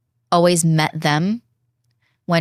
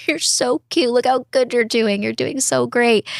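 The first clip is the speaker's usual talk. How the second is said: higher, sing-song praise to the mirror; pitched-up cheerleading of herself; cutesy self-praise; 7 semitones higher than usual. Retold or imitated. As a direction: coo at yourself like a proud friend. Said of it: "I'm like".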